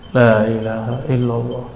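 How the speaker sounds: low, soft recitation; mindful recitation. Shown as a demonstration. Preacher shows reciting with aware mind, soft low recitation. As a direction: recite inwardly, hushed and low. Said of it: "pikiran sadar".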